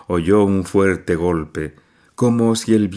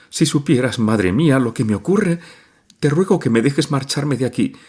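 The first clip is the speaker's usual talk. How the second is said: higher, pleading through light sobs; tearful plea; 7 semitones higher than usual. A narrator's reading. Nadia begging her mother at night, tearful. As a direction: beg her mother to let her go. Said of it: "profirió entre sollozos".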